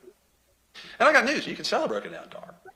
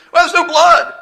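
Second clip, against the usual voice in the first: mocking counsel; 13 semitones higher, 19 dB louder, slower than usual. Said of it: "Then they make".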